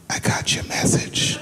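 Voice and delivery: slow, voiceless whisper; retold secret, hushed and deliberate; whispered in ear. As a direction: whisper it confidentially into someone's ear. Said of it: "He said in my ear".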